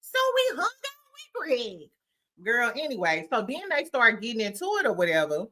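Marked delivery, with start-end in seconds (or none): 0.0-1.9 mocking Simone. Mocking Simone as Roger Rabbit, high squeaky voice. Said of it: "so Roger Rabbit like".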